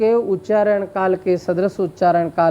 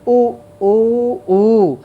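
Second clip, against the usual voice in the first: stretched, raised "उ, उ, उ" like a crowing cock; rooster call; 3 semitones higher, 4 dB louder, much slower than usual. Shown as a demonstration. Teacher imitating a rooster's crow for the class. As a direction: crow the "उ" sounds, drawing the last one out. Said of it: "मुर्गे की जो ध्वनि को".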